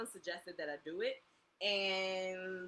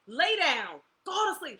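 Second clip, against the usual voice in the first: louder, higher bedtime command; stern mom order; 7 semitones higher, 10 dB louder, slower than usual. Retold or imitated. Mom ordering her son to bed, louder and higher. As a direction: lay down the law. Retold telling son to sleep.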